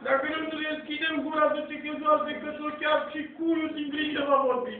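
usual speaking voice

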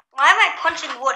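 usual speaking voice

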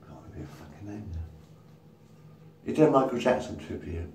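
ordinary talk

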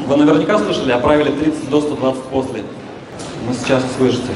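usual speaking voice

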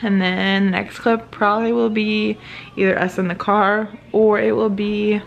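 ordinary talk, nothing marked

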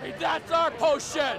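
indignant protest. Acted.

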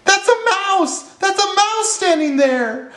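very high frightened squeal; squeaky panic, stretching out the final pleas; panicked elephant shout. A narrator's reading. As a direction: plead in cartoon terror, high-pitched. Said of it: "shouted the elephant".

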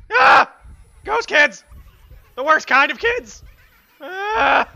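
loud high startled shouts; startled screams. Real, not acted. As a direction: scream and shout as the ghosts jump out. Scare from ghost kids.

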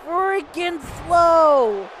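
very slow, loud ending; stretched, fed-up complaint; exasperated drawl. Real, not acted. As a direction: drag it out in frustration. Complaining about slow referee.

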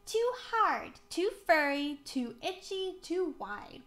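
emphatic rejections, starting high and easing down; proclaiming. A narrator's reading. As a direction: proclaim a list of complaints, high at first. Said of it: "proclaimed McCoy".

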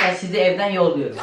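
normal talking voice

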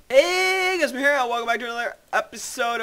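excited. Real, not acted. Video intro greeting.

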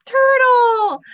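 high, loud shout; big one-word reveal, loud and high; excited call-out. Pretend. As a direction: call out the animal's name for the children. Announces next animal to children.

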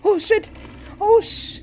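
sudden high, loud cry of shock; jump-scare yelp, real fright; startled yelp. Real, not acted. Player jolted by the horror game, startled yelp.

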